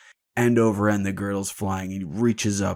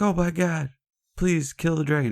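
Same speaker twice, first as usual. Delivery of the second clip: much higher, a pleading panicked cry; mock panicked townsfolk; 9 semitones higher than usual. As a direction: townspeople begging in hammed-up fear. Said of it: "And they're like".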